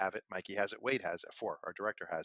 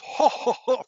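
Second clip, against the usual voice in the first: much higher, louder imitated ho ho laugh; imitated audience guy's booming ho ho laugh; the regular in the audience, mimicked booming laugh; 14 dB louder, slower than usual. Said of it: "including we hear that guy's laugh".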